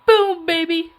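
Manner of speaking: Cartman-style boast; South Park quote. Retold or imitated. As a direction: punch it out like a cartoon kid. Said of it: "but I say, I say".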